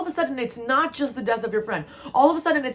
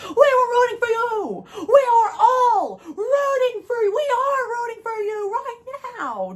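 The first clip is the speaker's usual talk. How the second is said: very high, tearful shouted cheer; hammed-up crying high yell; Tyra Banks; 4 dB louder than usual. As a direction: sob it out like a dramatic TV host. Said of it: "in the Tyra Banks".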